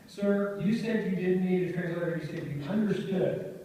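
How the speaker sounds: mild mock exasperation; shocked judge.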